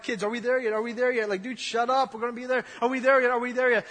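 lightly played nagging kids in the back seat; whiny kids. Preacher voices the Israelites as nagging kids, mild whine. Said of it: "They're like".

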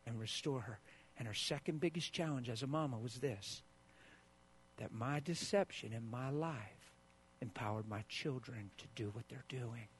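usual speaking voice

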